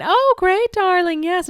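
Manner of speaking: high, loud mock cheerfulness; acting like everything's fine. Hiding panic from him on the phone, overplayed cheer. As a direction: put on breezy delight to cover panic. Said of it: "acting like everything's fine".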